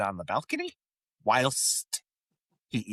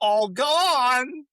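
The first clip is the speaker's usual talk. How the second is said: mock triumph; 12 semitones higher, 5 dB louder than usual. [name] gulping the dessert.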